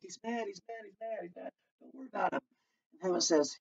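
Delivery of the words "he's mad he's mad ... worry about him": soft, quick apologetic aside; hushed excuse. Retold or imitated. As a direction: quietly explain him away. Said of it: "He's like".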